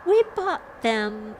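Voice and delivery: "bought" leaned on, high and slow; stress on BOUGHT. A narrator's reading. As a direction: draw out the word "bought" pointedly. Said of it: "with an emphasis on the word bought".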